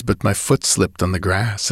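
plain reading voice

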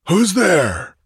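high, harsh sentry's challenge. A narrator's reading. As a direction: bark out a wary challenge. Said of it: "challenged a harsh voice".

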